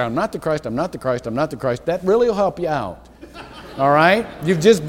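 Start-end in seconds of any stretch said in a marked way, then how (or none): 0.0-1.9 repeated, each time lower and softer, stress on not; fading daily recitation. Shown as a demonstration. Preacher models a daily self-reminder, fading to soft. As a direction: say it to yourself, letting it fade. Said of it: "if you get up every day and say".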